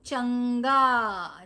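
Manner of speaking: slow, stretched चंगा with a held nasal; teacher sounding out चंगा for children; drawn-out model word. Shown as a demonstration. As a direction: hold the nasal in चंगा, slowly. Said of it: "च के ऊपर बिंदी और गा".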